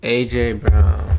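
A J Brown drawn out slowly; slow, decided pick; slow and deliberate. Real, not acted. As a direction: savour the name slowly. Settling on his pick.

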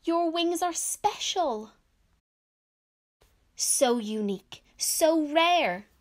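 high, loud storybook exclamations; emphatic, shocked protest for children; shocked classmates. A narrator's reading. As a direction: exclaim in acted shock, voice jumping up. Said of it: "Lara's classmates were shocked".

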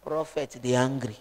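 a quiet thought said half aloud; a hint of a worried inner voice; hushed inner voice.

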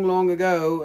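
ordinary delivery

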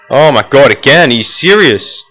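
can't believe it happened again; fed-up gamer; exasperated. Real, not acted. Frustration again mid-sentence.

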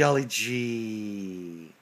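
slow drawn call. Real, not acted.

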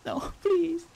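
laughing plea. Real, not acted. Gio begging off another story, laughing. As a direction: laugh through the refusal.

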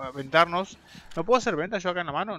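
usual speaking voice

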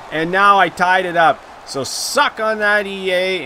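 loud high taunt; gloating at EA over penalty call. Real, not acted. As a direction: rub it in their face. Taunting the game maker.

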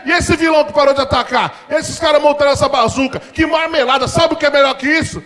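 high, loud, worked-up dad voice; dad raving at the TV; hyped-up father; excited dad rant. Retold or imitated. Dad excitedly ranting at cartoon.

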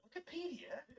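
whispered British imitation; barely audible mock British accent; Henry Cavill. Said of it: "le sale lo británico".

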